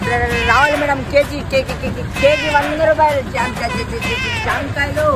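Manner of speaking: high, stuttering street-hawker cry; high-pitched guava seller drumming up customers; fruit vendor's call. Acted. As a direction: call out your wares in repeated stuttered syllables.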